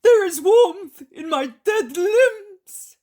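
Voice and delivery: very high, slow, loud cry; amazed shout. A narrator's reading. Healed paralytic feels his limbs come alive, amazed shout. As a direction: shout it slowly at the top of the voice. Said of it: "and then shouts".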